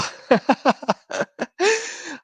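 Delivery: a high burst of laughter. Real, not acted. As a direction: laugh out, well above speaking pitch. Laughs at own quote.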